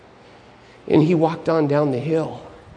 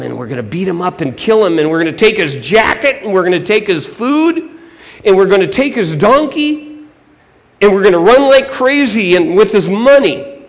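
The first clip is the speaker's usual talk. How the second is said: bad guy plan building in volume and pitch; rising relish, ending loud and eager; gleeful scheming villain; 4 semitones higher, 8 dB louder than usual. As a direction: get carried away listing the loot.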